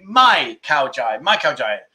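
slow Thai "Mai Kaljai" with falling tones; Thai pronunciation. Shown as a demonstration. Teacher showing viewers how to say "Mai Kaljai". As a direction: say "Mai Kaljai" slowly, each word falling. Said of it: "you would say".

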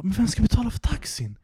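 high falsetto bewildered whine; puzzled drunk woman asking who pays, in a squeaky high voice; confused drunk woman. Retold or imitated. Mimicking the confused drunk passenger, high falsetto. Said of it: "Hon bara".

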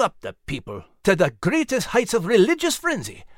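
a hint of an indignant clergyman; lightly voiced uncle making his case; uncle arguing. A narrator's reading. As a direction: uncle complaining about itinerant preachers. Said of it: "Uncle Patrick argued".

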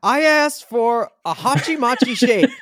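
high, indignant customer complaint; angry customer. Acted. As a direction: play the customer furious over a bad order.

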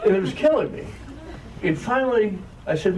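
ordinary delivery

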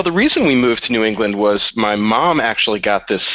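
ordinary delivery

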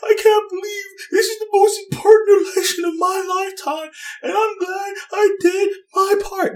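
hammed-up fake sob-story gush, high and cracked; fake weepy gushing voter. Vlogger mocking fake teary election videos, high hammed-up gush. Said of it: "this fake generic crap".

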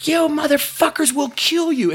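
high-pitched imitated heckler; mock crowd screaming. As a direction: imitate an angry crowd's screech. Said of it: "people are screaming at us".

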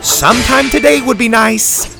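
high, loud sarcastic call; impatient shout. Acted. Grandma fighting off henchmen with her sword, impatient shout. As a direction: holler it, hurrying the slowpokes.

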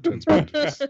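cranked up like a voice changer; high squeaky voice. Shown as a demonstration. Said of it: "I actually have the voice of a twelve-year-old boy".

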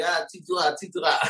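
high-pitched, put-on French local; lightly acted French direction-giver; fake helpful Parisian. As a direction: imitate a local cheerily sending tourists wrong. Giving tourists bad directions in French.

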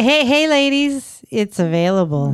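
mock pickup call, dropping at the end; sleazy come-on. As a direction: imitate a guy advertising himself to the ladies. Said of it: "it was like a si".

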